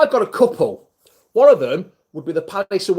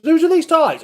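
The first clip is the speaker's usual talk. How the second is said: wife's voice; 11 semitones higher than usual. Retold or imitated. Wife voiced in story.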